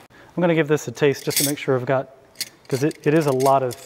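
ordinary talk

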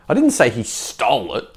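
"stole" stretched and punched; stress on STOLE. Shown as a demonstration. Coach showing he was only spoken about, stressing "stole". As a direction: hit the word "stole" hard. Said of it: "but you didn't say he stole it".

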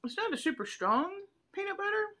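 with a mouthful of ice cream; eating mid-sentence; talking while chewing. Real, not acted. Seen 2: Bites just before; mouth working while speaking; bar held throughout.

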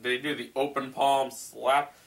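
ordinary talk, nothing marked

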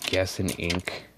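ordinary talk, nothing marked

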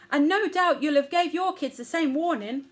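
high, loud, self-righteous scolding; indignant mother elephant. A narrator's reading. Mother to the aunties about warning the kids, indignant. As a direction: complain loudly, seeking agreement.

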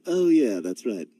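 normal talking voice

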